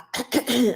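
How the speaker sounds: imitated scratchy throat-clear. Showing viewers how laryngitis starts, throat clearing. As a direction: demonstrate clearing your throat. Demonstrates throat trouble from strawberries.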